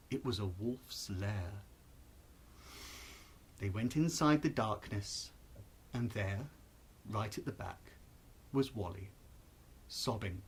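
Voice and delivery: hushed suspense in the wolf's lair. A narrator's reading. Narrator hushed cave scene.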